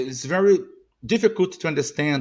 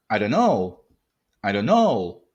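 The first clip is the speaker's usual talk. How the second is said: know lengthened with a falling end; stress on know; 6 semitones lower than usual. Shown as a demonstration. Teacher showing final stress to learners, stress on know. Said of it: "when the final, it's the stress".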